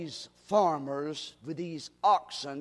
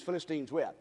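ordinary delivery

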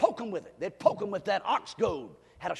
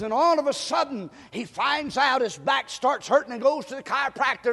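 raised, driving testimony building to loud, high exhortation; impassioned preaching; 6 semitones higher, 6 dB louder than usual. Real, not acted. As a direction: preach the story with mounting urgency. Preaches testimony with rising force.

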